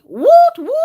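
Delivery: high, loud woot woot; excited cheer. Real, not acted. Celebrating an empty sticker sheet, a high loud cheer. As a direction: cheer like you won.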